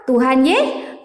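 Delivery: ordinary delivery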